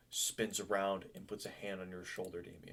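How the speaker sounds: ordinary talk, nothing marked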